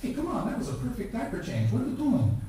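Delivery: high, exasperated mock complaint to a baby; half-joking indignant grandpa; mock anger. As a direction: play grandpa getting mad at the baby. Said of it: "I got mad".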